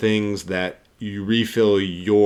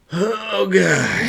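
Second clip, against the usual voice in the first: pained gasp; 11 semitones higher, 6 dB louder than usual. Retold or imitated. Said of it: "I was like".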